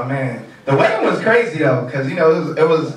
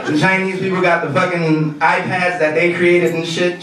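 ordinary talk, nothing marked

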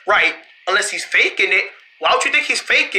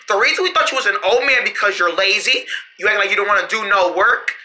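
normal talking voice

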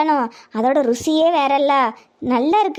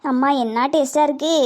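normal talking voice

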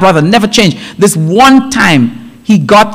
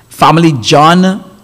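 ordinary talk

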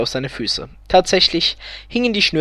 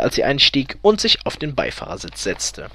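plain reading voice

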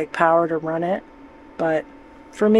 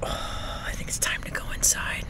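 whisper; 10 dB quieter than usual. Real, not acted. Quiet night outside, whispering.

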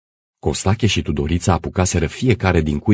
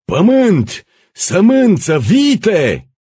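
high, loud one-word demands; howling crowd; 7 dB louder, much slower than usual. Acted. Crowd at the manor gate making demands, howling. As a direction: yell each demand separately. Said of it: "Mulțimea se grămădi în fața porții urlând".

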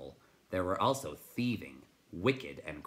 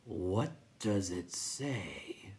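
very slow, whispery creature; crackling; much slower than usual. A narrator's reading. Rock Chewer asks about the message, slow crackle. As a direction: drag the question out in a crackle. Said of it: "the Rock Chewer crackled".

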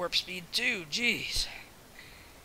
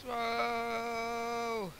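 long, held, higher "whoa"; drawn-out whoa; 6 semitones higher, 3 dB quieter than usual. Shown as a demonstration. Demonstrating a silly long sound for viewers, drawn-out whoa. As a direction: sustain one steady whoa. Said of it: "when I go like".

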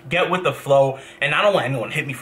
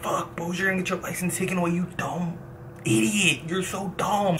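softer and higher, scolding himself; muttered self-scolding; 5 semitones higher, 6 dB quieter than usual. Retold or imitated. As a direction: quietly berate yourself for being dumb. Berating himself while waiting.

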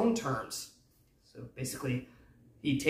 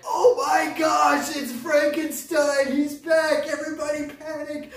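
loud, high-pitched mock panic yell; hammed-up freak-out, shouted high; panicked crowd; 14 semitones higher, 9 dB louder than usual. Townsperson spotting Frankenstein in town, hammed-up panic. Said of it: "everyone s-, starts freaking out".

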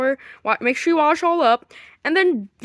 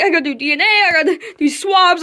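normal talking voice

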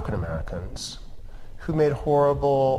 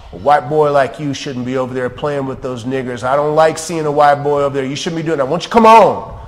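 loud, high scolding man; retold scolding; 5 semitones higher, 13 dB louder than usual. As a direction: bark the lecture like the bigot. Racist man scolds the boy.

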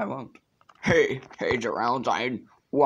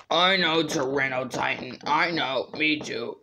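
ordinary delivery

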